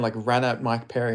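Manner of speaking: ordinary delivery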